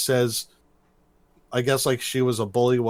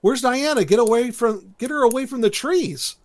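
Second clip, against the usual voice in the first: high-pitched imitation of a girl calling out; mock urgent cry, pitched way up; retold yelling; 11 semitones higher than usual. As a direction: imitate a girl's alarmed yell without full volume. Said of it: "the girl yells".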